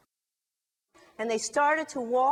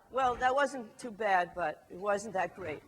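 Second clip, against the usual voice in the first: plain reading voice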